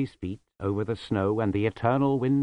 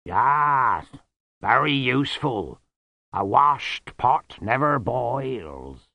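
loud, slow, booming Yeti; a big friendly beast shrugging it off; slow, loud, easygoing Yeti voice; good-natured Yeti; 5 semitones higher, 8 dB louder, much slower than usual. Acted. Yeti character speaking.

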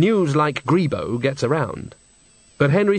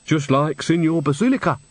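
usual speaking voice

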